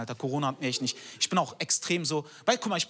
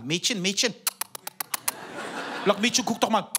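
lightly voiced street-kid calling after girls; mock the lads calling out to girls; half-hammed catcall; catcalling street boys. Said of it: "so, original".

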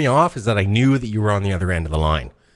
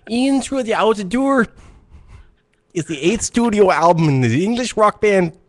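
put-on Mexican Canadian accent; 10 semitones higher than usual. Host trying an accent on a radio show, awkward put-on accent. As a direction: read the album facts in a shaky put-on Mexican Canadian accent. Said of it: "This is me doing a, a Mexican Canadian".

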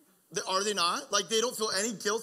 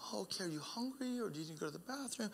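put-on much softer, gentle question to a toddler; soft parent voice; 12 dB quieter than usual. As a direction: ask your little one softly. Father answering his toddler.

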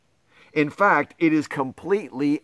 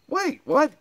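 pitched way up in fake alarm; mock surprise; 12 semitones higher than usual. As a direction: squeak it out, caught off guard. Reader tricked into nonsense.

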